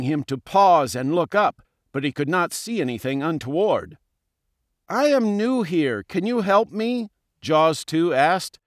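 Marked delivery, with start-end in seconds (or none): 4.9-7.1 higher, hopeful question; an innocent, higher-pitched request; friendly newcomer fish. A narrator's reading. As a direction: ask for help in a lifted little-fish voice. New fish asks for help.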